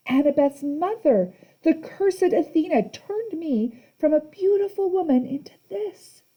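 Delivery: high, slow self-pitying lament; pitiful old grandmother. A narrator's reading. Medusa seeks sympathy from Percy, high and slow. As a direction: mourn it slowly, high.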